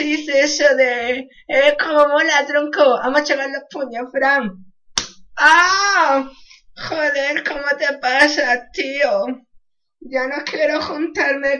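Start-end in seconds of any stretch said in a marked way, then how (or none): 5.4-6.3 a short loud yelp of pain; mock pained yelp; sudden yelp. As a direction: yelp as if hit.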